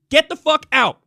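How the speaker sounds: shouted high until the voice cracks; cracking yell while telling them to leave. Real, not acted. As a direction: yell it so hard the voice breaks. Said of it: "I like how my voice cracked there".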